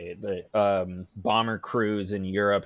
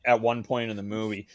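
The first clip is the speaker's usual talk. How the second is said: usual speaking voice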